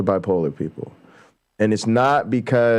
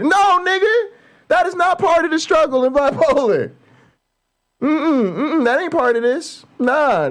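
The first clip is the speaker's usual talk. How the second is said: a high-pitched indignant yell; outraged shout. Real, not acted. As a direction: shout the excuse down. Rejecting the mocked excuse angrily.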